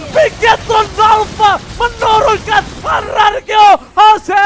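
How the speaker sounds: screaming caster, high and loud; shouted play-by-play. Real, not acted. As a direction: yell the wipe at the top of your voice. Teamfight climax call.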